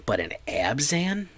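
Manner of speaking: high-pitched questioning disbelief; incredulous. Real, not acted. Incredulous at viewer's deck choice.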